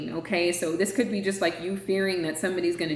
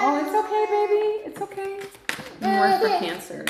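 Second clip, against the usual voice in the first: soothing a scared dog; 13 semitones higher than usual. Real, not acted. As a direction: coo gently to calm a frightened pet. Reassuring someone off-screen mid-reading.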